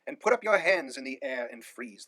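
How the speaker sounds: loud commanding cry; police shouting. A narrator's reading. Police officers shouting at the hiding cheese lover. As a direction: bark it like police closing in. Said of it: "they cried".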